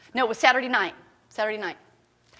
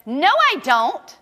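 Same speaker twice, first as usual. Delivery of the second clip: defiant refusal; 3 semitones higher, 7 dB louder, slower than usual. Retold or imitated. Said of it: "And I said".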